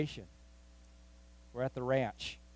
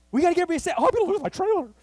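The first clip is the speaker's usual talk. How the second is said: high, loud panicky inner voice; thinking aloud in a panic, high and loud; panicked thought; 8 dB louder, much faster than usual. Retold or imitated. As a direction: spiral into panic.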